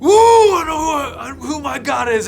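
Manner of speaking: high, loud triumphant yell. Retold or imitated. Yelling back at the attacking entity, loud and high. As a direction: shout it out in sudden defiance. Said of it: "I yelled this out in the middle of it".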